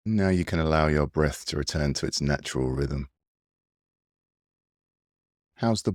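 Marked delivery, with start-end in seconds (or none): none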